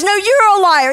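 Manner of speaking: high, loud mock yell; mom yelling back. Mom shouting back at her son at the airport, loud mock yell. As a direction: fire it back in a shouting match. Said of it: "She goes".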